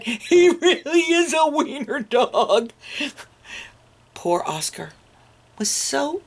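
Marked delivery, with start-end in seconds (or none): 0.0-2.7 high, giggling taunt; laughing mockery. Mocking dogs teasing Oscar, laughing high. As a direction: laugh while teasing him. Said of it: "they cried".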